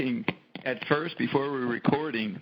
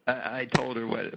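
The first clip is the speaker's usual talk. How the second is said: ordinary delivery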